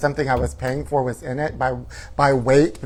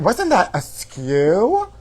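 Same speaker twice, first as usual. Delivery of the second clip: normal talking voice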